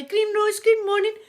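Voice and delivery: a hint of a cow calling good morning; mock cow voice. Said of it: "like that".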